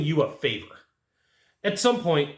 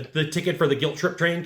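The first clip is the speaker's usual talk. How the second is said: normal talking voice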